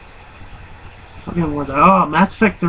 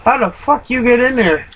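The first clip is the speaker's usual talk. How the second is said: higher-pitched, incredulous question; incredulous bike rider; 7 semitones higher than usual. Retold or imitated. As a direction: mimic a baffled stranger, pitched up. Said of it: "and he's like".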